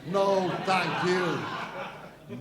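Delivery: very slow, stretched-out dismissal; drawn-out refusal. Acted. Comedian dismisses the complaining receptionist, drawn-out. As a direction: draw every word right out.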